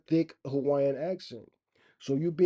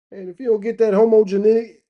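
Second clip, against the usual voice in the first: much higher and louder, hyped up; belting it out with glee; excited outburst; 8 semitones higher, 12 dB louder than usual. Real, not acted. Excited playful threat about word.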